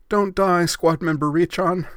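high, loud dramatic plea; pleading. Acted. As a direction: beg her friend not to die, hammed up. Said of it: "Yui pleaded".

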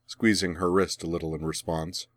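ordinary delivery

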